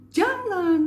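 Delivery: high, loud angry command; angry intonation. Shown as a demonstration. Coach demonstrating anger for learners, angry shout. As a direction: forbid it with a loud, high burst. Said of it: "intonasi marah".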